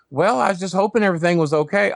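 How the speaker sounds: high, sugary phone voice with a laugh; ex-girlfriend Rhonda. Retold or imitated. Ex Rhonda calling him on the phone, high and sugary. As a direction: play the ex calling sweetly after months. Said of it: "It's Rhonda".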